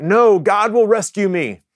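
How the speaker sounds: high-pitched retold holler; calling back. Stranded man refuses the fishing boat, calling back. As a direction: call back in a mildly hammed-up high voice. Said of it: "the man calls back".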